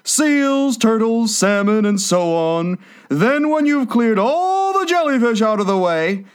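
high-pitched turtle character voice; eager Mock Turtle. A narrator's reading. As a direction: explain keenly in a high creature voice.

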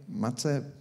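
ordinary talk

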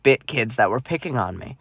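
normal talking voice

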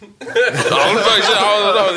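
slow, high laughing protest. Real, not acted. Laughing at interruption.

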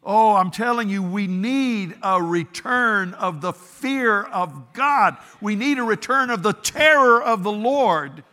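loud, high fervent preaching; full-force preaching. Real, not acted. Preacher crying out to his congregation, loud and fervent. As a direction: thunder it from the pulpit.

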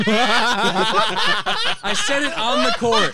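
loud, slow, laughing aside. Real, not acted. Telling friends about gym class, loud and laughing. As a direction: burst out laughing as you say it. Laughs recalling his own quip.

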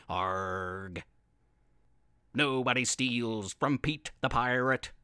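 low, growling pirate Pete; menacing pirate vow, low; gruff pirate voice. Acted.

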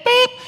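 high squeaky bleep; mock bleep. Shown as a demonstration. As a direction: bleep out the word. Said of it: "bleep sound". (pitido agudo de censura)

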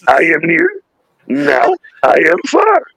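high Muppet monster voice, slowing on the second line; Grover. Retold or imitated. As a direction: teach near and far like a puppet monster.

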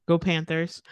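ordinary talk

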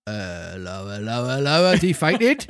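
police officer finding a puppet on the ground, bobby voice; Victorian bobby. Acted. Carabinier voice.

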